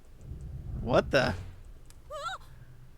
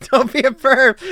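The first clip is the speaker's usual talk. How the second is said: laughing; 13 semitones higher, 14 dB louder than usual. Real, not acted. Reading teasing game line, laughing.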